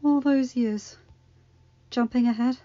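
out of breath while dying; breathless. Acted. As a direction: gasp between the phrases.

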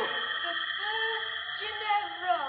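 very slow, eerie ghost voice; ghostly warning. Acted. Ghost warns Wentworth to leave, slow eerie voice. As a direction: stretch each word like a spirit.

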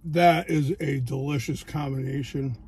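speaking between bites; chewing while talking. Real, not acted. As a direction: mouth partly full of food. Seen 3: Bites pizza at -3s; jaw and pursed lips suggest chewing while speaking.

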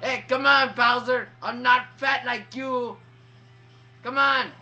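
loud, worked-up trash talk; shouted taunting. Real, not acted. Player yelling at Bowser in a fight, taunting shout. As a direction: call the opponent out at full voice.

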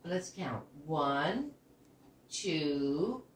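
usual speaking voice

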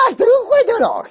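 high, alarmed call to mom; daughter crying out. Retold or imitated. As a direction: shout for mom, the computer really broke. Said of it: "他说".

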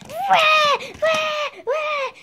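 high, loud fake baby cries; baby wailing. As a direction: wail like a crying baby. Doll crying played by girl.

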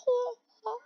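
very high, soft hoots; mimicked cries. Viewer voicing the llamas, high soft mock cries. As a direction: squeak out two high, light "oh" sounds. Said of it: "They're like".

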